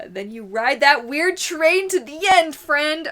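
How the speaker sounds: slow, loud rhyming punchline; emphatic slogan. Real, not acted. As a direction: sell the catchphrase. Motivational punchline.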